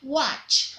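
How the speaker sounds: Watch with a crisp final tch; clear model word. Shown as a demonstration. Teacher modelling Watch for pupils to repeat.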